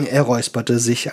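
plain reading voice

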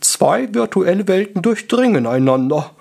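high, breathed wizard wonder; awed wizard. A narrator's reading. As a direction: breathe it out in theatrical awe. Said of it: "hauchte Gaston".

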